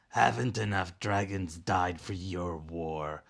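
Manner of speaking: usual speaking voice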